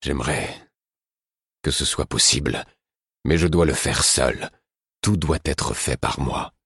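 understated gloomy Verity. A narrator's reading. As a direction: refuse her help with gloom. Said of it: "d'un air lugubre".

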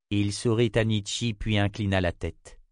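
plain reading voice

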